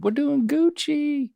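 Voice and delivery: high, drawn-out catchphrase; catchphrase demo. Shown as a demonstration. Teaching Dad what you say when doing good, high drawn-out catchphrase. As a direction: stretch it out up high, playfully. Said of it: "That's what you say when you're doing good".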